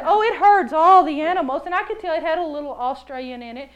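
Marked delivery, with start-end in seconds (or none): 0.0-1.6 loud, gushing boast; mock sales pitch. Pound staff pitching a stir-crazy dog, loudly overselling. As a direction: oversell it at full volume.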